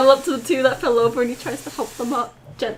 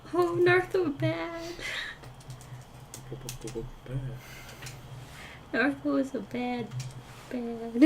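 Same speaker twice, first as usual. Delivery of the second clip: dejected self-blame; 16 dB quieter than usual. Acted. Character sits alone, blaming himself.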